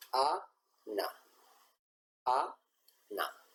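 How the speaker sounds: "Ana" read syllable by syllable, the Korean way. Shown as a demonstration. Teacher modeling the Korean reading of Ana. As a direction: sound out "Ana" as the Korean letters spell it. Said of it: "como normalmente sonaría la palabra Ana".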